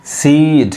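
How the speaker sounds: "सीड" with the ee held long; drawn-out "सीड"; long vowel. Shown as a demonstration. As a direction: stretch the ee in "सीड" before the d. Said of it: "long sound".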